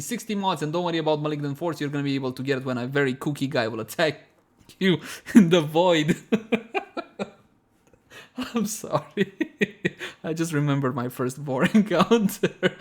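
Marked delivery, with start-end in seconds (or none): 4.5-12.8 cracking up, voice climbing as laughter takes over; real laughing fit. Real, not acted. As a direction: try to apologize through helpless giggles. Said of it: "From laughing to crying".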